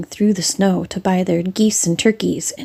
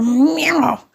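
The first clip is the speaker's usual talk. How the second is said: angry cat meow; 6 semitones higher than usual. Acted. Simpkin bounces in angry.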